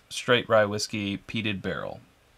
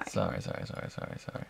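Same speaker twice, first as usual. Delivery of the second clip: whispered apology; 4 semitones lower, 12 dB quieter, faster than usual. Real, not acted. Apologizing for upsetting her.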